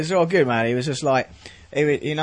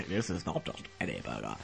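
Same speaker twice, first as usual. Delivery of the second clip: M&S ad voice; 13 semitones lower, 12 dB quieter than usual. Pretend. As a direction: purr it like a luxury food advert. M&S ad voice parody.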